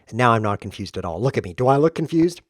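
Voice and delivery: usual speaking voice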